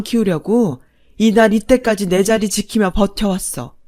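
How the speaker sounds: loud, bitter tirade; long-suffering wife, finally furious. Acted. Wife telling off her husband after years of enduring, bitterly furious. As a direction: let years of endurance burst out hard.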